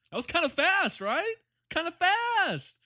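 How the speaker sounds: mock-proud cartoon squeak; squeaky put-on voice. Squeaky self-praise voice.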